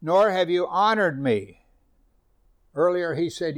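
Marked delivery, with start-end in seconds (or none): 0.0-1.7 a touch higher, louder declaiming; proclaiming scripture. Acted. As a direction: voice God's tired complaint. Scripture read.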